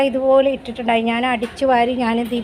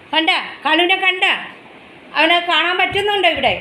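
eager question; 6 semitones higher than usual. Real, not acted. Excited, asking viewers to spot Kalu.

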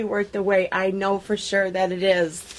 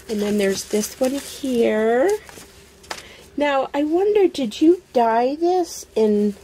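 normal talking voice

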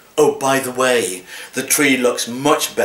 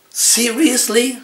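incredulous; 11 semitones higher, slower than usual. Acted. Cat poem, final disbelief.

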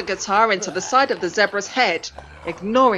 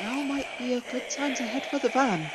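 quiet, trembling nervous voice; shaky voice; 5 semitones higher, 6 dB quieter than usual. A narrator's reading. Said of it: "Axel said in a shaky voice".

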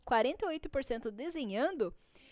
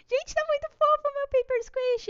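much higher, louder squeal of delight; cute gushing; 12 semitones higher, 5 dB louder than usual. Real, not acted. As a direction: gush over something adorable. Admirando o Paper Squish pronto. (voz aguda e encantada de fofura)

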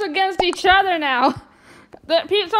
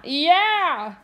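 usual speaking voice

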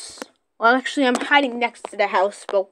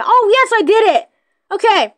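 excited; 8 semitones higher, 4 dB louder than usual. Real, not acted. Catching the seeker.

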